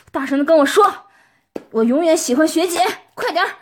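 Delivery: urgent loud command; loud demand. Acted. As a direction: order them to say it out loud. Demanding partner repeat loudly.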